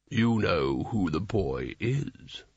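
low, slow and knowing; knowing wizard. A narrator's reading. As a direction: reveal you see through him. Carding's knowing remark.

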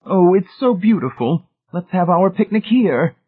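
high, bright delighted exclamation; delighted cry. A narrator's reading. Mrs. Pritchett delighted by the poppies, high excited exclamation. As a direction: gush with delight at the flowers. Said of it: "gave a delighted cry".

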